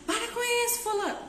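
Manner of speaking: high, shrill-free angry yell of a stressed parent; yelling at child. Shown as a demonstration. Stressed parent yelling at a misbehaving child, high angry yell. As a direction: snap at the kid in a high yell. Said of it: "Não é assim".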